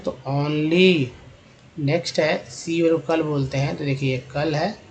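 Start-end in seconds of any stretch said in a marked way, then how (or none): 0.3-1.1 only drawn out, ending -ली; only stretched for learners; slow clear word. Shown as a demonstration. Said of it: "एलवाई को ली बोलते हैं".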